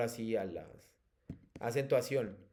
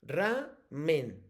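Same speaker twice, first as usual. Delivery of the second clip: "Ramen" broken into ra, pause, men; split syllables. Shown as a demonstration. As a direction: sound out "Ramen" slowly in two separate beats. Teacher sounds out word for pupil.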